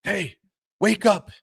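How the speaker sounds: high, loud yell at the ref; a coach yelling from the bench; angry coach. Retold or imitated. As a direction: coach yelling at the referee, mock angry shout. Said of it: "He goes".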